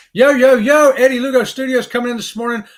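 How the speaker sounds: loud, high hailing call; excited, shouted welcome; hyped shout-out. Real, not acted. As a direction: hail a friend coming in. Hailing a viewer joining the stream.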